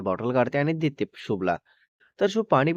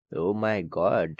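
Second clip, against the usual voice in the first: very slow, lower "oh my God"; long, low exclamation of dismay; drawn-out dismay; 5 semitones lower, much slower than usual. Acted. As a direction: stretch each word out in dismayed disbelief. Narrator's aside after a heated scolding.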